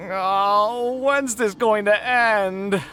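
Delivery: high, slow, drawn-out wail of despair; exasperated lament. Acted. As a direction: stretch it out like a man at the end of his rope. Kaveh lamenting his endless debts.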